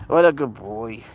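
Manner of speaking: usual speaking voice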